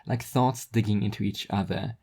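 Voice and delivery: usual speaking voice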